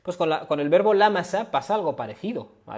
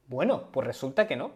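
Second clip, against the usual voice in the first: ordinary talk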